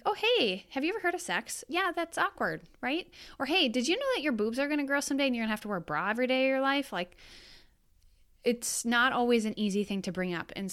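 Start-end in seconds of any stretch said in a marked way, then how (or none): 0.0-3.1 high, fast awkward chirp; awkward mock opener. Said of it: "it can be awkward to just be like". 3.5-6.9 awkward blurt. Retold or imitated. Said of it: "awkward to just be like".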